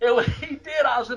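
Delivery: words squeezed out through laughter; laughing. Real, not acted. Remembering the fan sitting down, real laughter.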